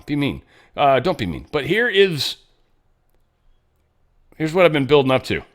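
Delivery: ordinary talk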